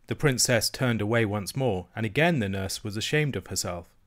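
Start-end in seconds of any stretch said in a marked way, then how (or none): none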